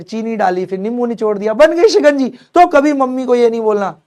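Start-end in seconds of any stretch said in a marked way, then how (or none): 1.5-4.0 excited punchline. Acted.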